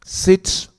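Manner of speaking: usual speaking voice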